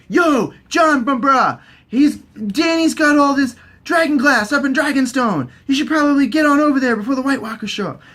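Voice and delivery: high, loud, urgent bro call; Sam. Retold or imitated. Sam messaging Jon about dragon glass, high urgent shout. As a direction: holler it like an excited buddy. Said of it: "from Sam and is all like".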